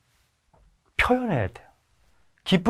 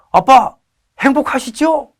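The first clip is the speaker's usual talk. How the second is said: bright son; 11 semitones higher, 6 dB louder than usual. Retold or imitated. Son asking on phone.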